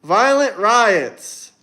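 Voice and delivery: usual speaking voice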